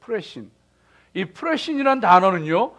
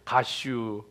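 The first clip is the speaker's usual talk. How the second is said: short, softer Chungcheong clipped word; lightly mocked Chungcheong accent; Chungcheong dialect; 5 semitones lower, 7 dB quieter, much slower than usual. Shown as a demonstration. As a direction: toss it off lazily, Chungcheong style. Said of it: "충청도 사람은요, 아주 경제적으로 얘기해".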